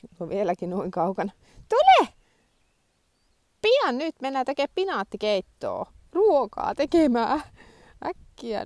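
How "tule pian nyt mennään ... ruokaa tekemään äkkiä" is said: high, loud coaxing call; high loud call to come inside; calling the dog in. Real, not acted. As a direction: hurry the dog along cheerfully.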